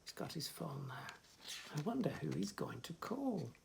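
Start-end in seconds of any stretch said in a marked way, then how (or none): none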